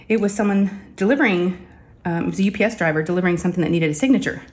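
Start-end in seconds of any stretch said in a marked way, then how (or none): none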